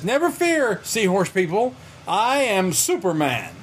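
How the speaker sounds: high, slow proclamation; heroic Superman. Retold or imitated. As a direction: announce it like a cartoon hero.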